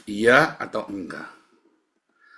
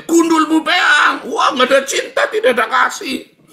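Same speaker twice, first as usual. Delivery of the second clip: blunt shout; 13 dB louder than usual. Retold or imitated. Preacher showing his own harsh talk, shouting high. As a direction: blast it out like a rough-talking man. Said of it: "Kalau ngomong kayak saya".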